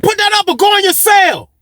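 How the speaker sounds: very high-pitched lady guard voice; imitated guard order. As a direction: mimic a woman calling out an order.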